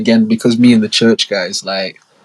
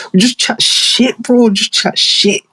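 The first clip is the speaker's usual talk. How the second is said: high excited exclaim; 12 semitones higher, slower than usual. Real, not acted. Storyteller describing their teen banter.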